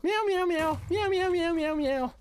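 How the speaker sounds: quick run of high-pitched meows; meowing on request. Pretend. Streamer obliging a viewer who gifted subs, meowing on request. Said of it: "Can you meow now, okay?".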